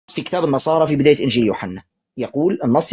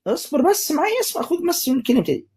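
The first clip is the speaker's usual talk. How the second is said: urgent high plea; 10 semitones higher, 3 dB quieter than usual. Real, not acted. Urging the other to listen.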